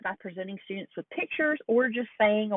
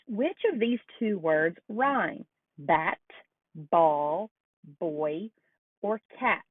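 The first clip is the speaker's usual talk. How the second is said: slow, clear question to a student; teacher quiz voice; much slower than usual. Shown as a demonstration. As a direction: ask it like a teacher quizzing a child. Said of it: "an example task might be, asking a student".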